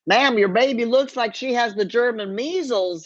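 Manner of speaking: an immigration agent in a hammed-up Texas accent; thick Texas accent. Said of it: "he said in a thick Texas accent".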